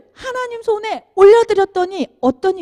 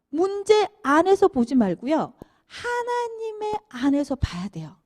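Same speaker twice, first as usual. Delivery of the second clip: ordinary talk, nothing marked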